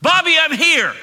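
loud high call across the room; mother calling out to her scared child, loud and high; yelling a name. Retold or imitated. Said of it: "and yell his name".

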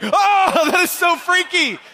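high, loud horrified outburst; freaked-out cry. Acted. Comedian reacts to creepy kid story, crying out high. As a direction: wail it in comic horror.

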